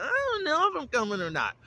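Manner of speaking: very high sing-song mimicry, falling at the end; mock indecision. Mimicking a guest unsure about coming, mock indecision. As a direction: mimic them in a squeaky-high, wavering whine.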